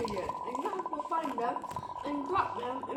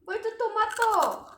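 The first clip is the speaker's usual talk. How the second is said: loud call, pitched up; yelling across the room; 6 semitones higher, 6 dB louder than usual. Real, not acted. Searching for tomato in game.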